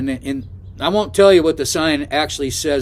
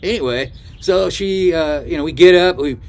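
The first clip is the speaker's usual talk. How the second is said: normal talking voice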